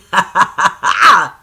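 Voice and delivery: loud, bursting cackle; loud laugh. Real, not acted. Laughing at own failing brain.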